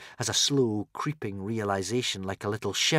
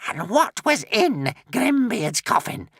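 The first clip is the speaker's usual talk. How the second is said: hissed; 13 semitones higher, 3 dB louder, slower than usual. A narrator's reading. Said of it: "hissed the witch".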